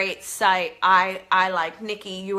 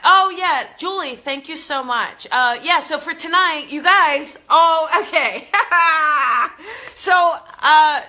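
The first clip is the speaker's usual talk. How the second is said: loud, high laughing burst; excited laughing; 5 semitones higher, 6 dB louder than usual. Real, not acted. Laughing at live chat comment.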